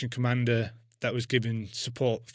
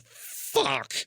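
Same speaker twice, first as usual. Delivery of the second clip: high, squeaky letdown; crushed disappointment; dismayed fuck. Retold or imitated. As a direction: let it go up in a high disappointed whine. Retold reaction to refusal.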